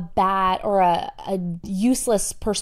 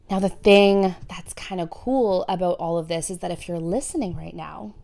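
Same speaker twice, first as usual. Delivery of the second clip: ordinary delivery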